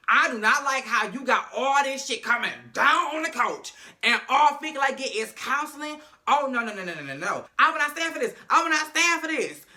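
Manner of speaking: high, loud indignant tirade; Wendy storming the principal's office in a loud, high rant; furious rant. Retold or imitated. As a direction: tell the principal off at full volume.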